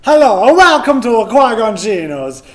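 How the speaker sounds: put-on loud welcome in an Australian-slipping accent; failed Italian accent, sounding Australian. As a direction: greet in a botched Italian accent. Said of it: "I'm not gonna do the accent 'cause for some reason it's Australian".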